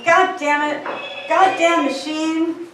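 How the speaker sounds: restrained, drawn-out exasperated outburst; mild recited yell. A narrator's reading. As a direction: curse the machine within a poem reading.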